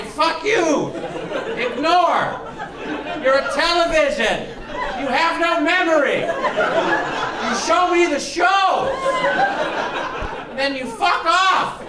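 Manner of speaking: high-pitched yelling at a gadget; indignant, pitched far up, stretching the last words; exasperated comic tirade. Acted. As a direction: rail at the TV in hammed-up outrage. Acted tirade at his television.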